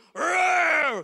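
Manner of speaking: loud shouted growl; lion roar. Retold or imitated. Big lion roars again at trembling young lion, shouted growl. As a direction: let the big lion roar at full force. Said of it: "So nag-roar yung leon".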